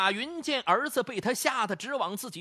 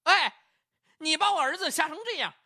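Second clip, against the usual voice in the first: higher, louder indignant shouting; 7 semitones higher than usual. Acted. Mother shouts back at the shop assistant, indignant and loud. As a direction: yell back, outraged at the accusation. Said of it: "大声喊道了".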